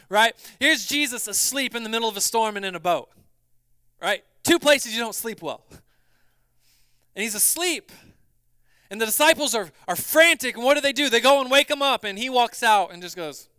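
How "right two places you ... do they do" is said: ordinary delivery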